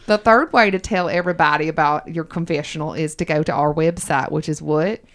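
put-on Southern accent. Host quizzes co-host on their show in a Southern accent. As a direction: quiz her in a playful Southern accent. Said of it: "in my Southern accent".

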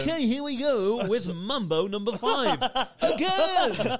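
loud, high, slow song introduction; stretched DJ patter rising to a shout; mock club DJ. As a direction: announce the track as a hammed-up DJ.

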